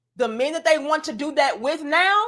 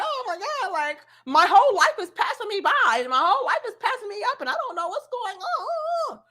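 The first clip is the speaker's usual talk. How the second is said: high, whiny mock wail; mock the crying woman; 7 semitones higher than usual. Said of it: "Now you wanna cry because it's like".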